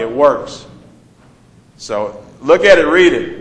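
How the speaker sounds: normal talking voice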